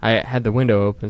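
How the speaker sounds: usual speaking voice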